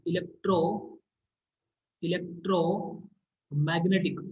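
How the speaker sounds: Electromagnetic drawn out very slowly, with a break; slow dictation. Real, not acted. Teacher writing the term for students, electromagnetic in slow dictation.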